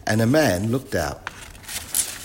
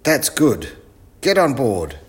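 loud, high instruction; eccentric professor; 5 semitones higher, 6 dB louder than usual. Acted. Professor orders the children aboard, loud and high. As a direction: usher them aboard loudly.

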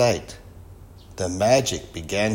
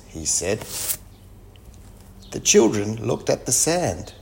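ordinary delivery

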